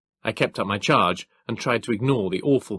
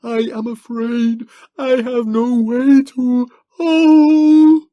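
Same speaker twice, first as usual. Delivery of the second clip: high, slow, loud, rising to a yowl; anguished; 10 dB louder, much slower than usual. Acted. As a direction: terror building into a wail. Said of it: "wordless yowl of anguish".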